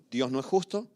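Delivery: ordinary talk, nothing marked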